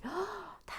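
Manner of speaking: sharp surprised gasp; breathy shocked gasp. Real, not acted. Shocked reaction to message.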